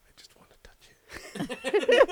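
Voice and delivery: unvoiced covetous whisper; cat longing for the water; breathless whisper. Cat voiced whispering desire.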